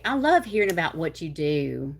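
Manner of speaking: ordinary delivery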